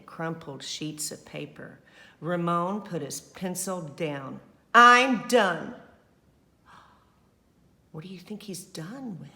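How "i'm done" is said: fed up. Acted. Ramon gives up drawing.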